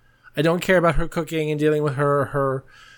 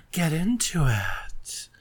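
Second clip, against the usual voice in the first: whisper; 4 dB quieter, slower than usual. Acted. Aside within persona intro.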